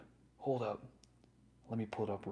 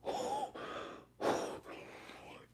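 long breathy gasp; shocked gasp; 4 dB quieter than usual. Real, not acted. Streamer sees what the card is worth, long shocked gasp. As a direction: suck in air, stunned.

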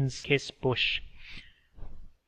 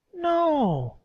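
put-on high, thin old woman's voice; old woman speaking through a laugh; old Meredy; 4 dB louder than usual. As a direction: voice her as a frail old village woman. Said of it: "shaking her head sadly".

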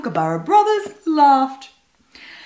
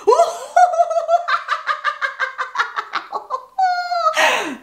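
high bird laughing cackle; high cackling bird laugh; kookaburra cackle. A narrator's reading.

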